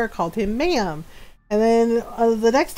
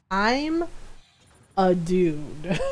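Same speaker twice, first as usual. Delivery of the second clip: low, halting, quieter deadpan; pause, then drop low on "a dude"; deadpan protest; 4 semitones lower, 6 dB quieter, much slower than usual. Retold or imitated. Ron after being called ma'am, halting deadpan protest.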